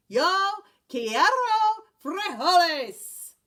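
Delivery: slow, solemn pronouncement; somber declaration. Acted. Don Diego declaring to the dogs, slow and somber. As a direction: declare it gravely, slowly. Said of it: "in his most somber voice declared".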